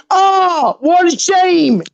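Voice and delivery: slow, high, sing-song fake pity; mock sympathy. Real, not acted. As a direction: feign sorrow to mock her. Mocking pity to Angie.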